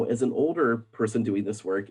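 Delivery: usual speaking voice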